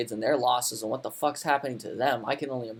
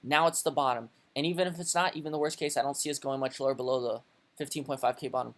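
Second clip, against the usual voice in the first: ordinary talk, nothing marked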